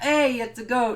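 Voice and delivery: high, loud mock cry of discovery; excited call-out. He spots the goat and calls out, excited. Recap acts out spotting the goat.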